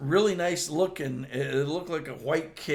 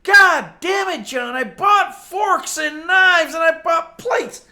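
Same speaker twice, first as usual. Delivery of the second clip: retold furious shout, slow and much louder and higher; angry shout; 14 semitones higher, 13 dB louder, slower than usual. Coworker yelling at John over stolen cake, slow loud shout. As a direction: yell it like a coworker whose cake was grabbed. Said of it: "he looked at me".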